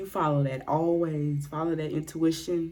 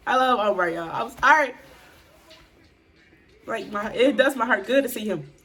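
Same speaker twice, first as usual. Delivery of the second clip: louder, laughing through the words; gushing excitedly; 4 semitones higher, 6 dB louder than usual. Real, not acted. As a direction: beam with fan delight. Gushing over Omar.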